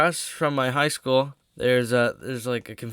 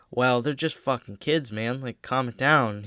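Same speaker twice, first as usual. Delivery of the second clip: ordinary talk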